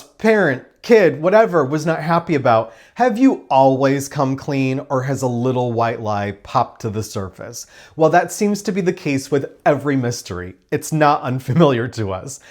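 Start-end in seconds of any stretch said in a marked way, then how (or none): none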